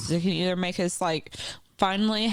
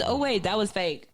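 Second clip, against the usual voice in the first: normal talking voice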